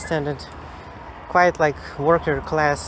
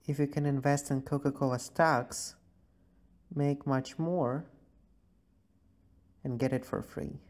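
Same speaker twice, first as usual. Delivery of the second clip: normal talking voice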